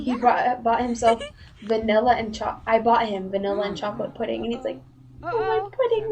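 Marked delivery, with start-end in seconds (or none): 5.2-6.1 high, whiny imitation of a crying little brother; mock crying whine. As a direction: wail it like a kid crying over pudding. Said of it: "my brother starts crying".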